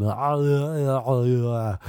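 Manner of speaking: put-on slurred drunken babble; mock-drunk noises running into each other; dopey drunk friend. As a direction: imitate a dopey, rambling drunk.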